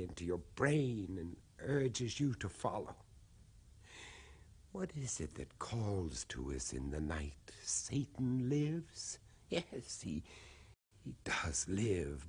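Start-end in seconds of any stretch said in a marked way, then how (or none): 4.7-12.3 slow hushed whisper; ominous whisper. Acted. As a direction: draw them in with a dark hush. Priest speaks of evil voices.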